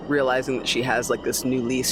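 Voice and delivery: ordinary delivery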